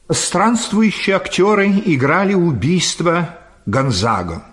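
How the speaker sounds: ordinary delivery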